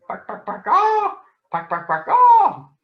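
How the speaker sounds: mock chicken clucks with high squawks; chicken clucking.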